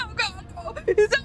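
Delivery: high, mocking voice of shocked dismay; 1 mock horror. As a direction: squeal it like you just saw yourself bald. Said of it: "and they're all bald and be like".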